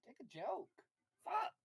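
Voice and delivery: usual speaking voice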